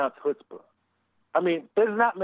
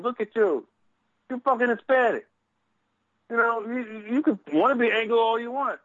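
higher-pitched imagined telling-off; retold scolding; 7 semitones higher than usual. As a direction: act out dressing someone down. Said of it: "I was like".

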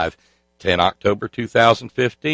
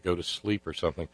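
ordinary talk

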